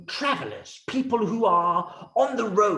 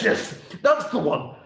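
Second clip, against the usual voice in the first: ordinary delivery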